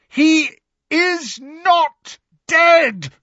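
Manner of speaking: high, loud, word-by-word roar. A narrator's reading. Harry cutting off Lupin's news of death, roaring. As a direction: roar it out slowly in desperate denial. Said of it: "roared Harry".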